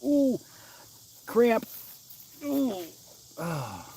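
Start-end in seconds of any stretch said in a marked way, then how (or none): 0.0-2.8 high yelps of sudden cramp pain. Real, not acted. Streamer caught by a hand cramp on camera, yelping.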